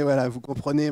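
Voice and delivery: ordinary talk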